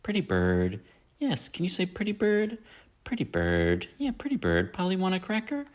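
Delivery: put-on high baby-talk to a parrot; coaxing a bird. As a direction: coo at the bird like a child. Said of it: "this is also another one".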